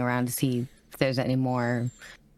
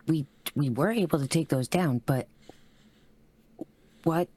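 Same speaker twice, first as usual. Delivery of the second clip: ordinary delivery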